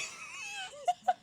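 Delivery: high-pitched real laughing fit; helpless laughter. Real, not acted. Cracking up over the Shrek argument.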